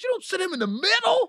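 very high mock scold; hammed-up owner. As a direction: lecture the host like the owner.

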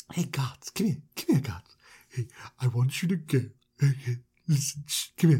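hushed, secretive beckoning; sneaky character voice from a corner; furtive put-on voice. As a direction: call someone over slyly in an odd voice. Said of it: "Why are we putting on that voice?".

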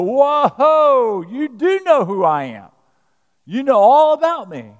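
loud, high, drawn-out whoa of amazement; hammed-up amazed exclamation; acted astonishment. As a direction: play her stunned that he knows. Acting the woman's astonished realization.

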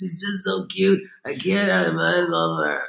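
slow, adoring mock coo with a laugh; besotted dad. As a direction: imitate a smitten father gushing slowly. Besotted husband imitated again.

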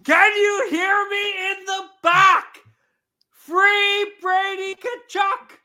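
high, slow, drawn-out yell; shouting. Acted. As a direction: bellow it to the back row. Calling out to the back.

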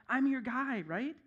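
lightly voiced eager servant; mock pleading. Preacher voicing Moses begging God for favor, mock plea. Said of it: "You're, you're like".

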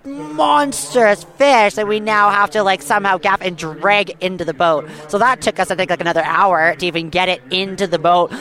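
normal talking voice

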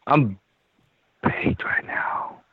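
slow unvoiced whisper; ASMR whisper. Real, not acted. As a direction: whisper it slowly, ASMR style. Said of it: "A S M R thing?".

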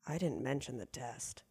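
very low, barely audible deadpan; hushed horror. Retold or imitated. Client realising the slip on the way out, in hushed dread. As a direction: drop right down to a near-murmur for the punchline. Punchline aside after technician's odd remark.

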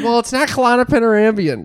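high, loud mimicry of mom; defensive mother imitation. Daughter mimics mom defending the weed pen, defensive. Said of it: "She's like".

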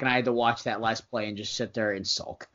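usual speaking voice

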